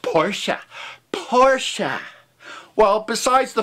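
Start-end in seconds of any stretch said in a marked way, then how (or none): 0.0-2.0 mimicked pedant, Porsche as "POR-shuh"; mock nitpicking. Imitating those who must point it out, mock fussy. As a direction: repeat Porsche as a fussy correction, higher the second time. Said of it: "to comment or bitch or point out".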